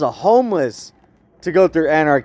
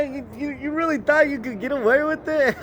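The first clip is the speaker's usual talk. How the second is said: mock villain taunt; 8 semitones higher, 6 dB quieter than usual. Taunting an imagined rival.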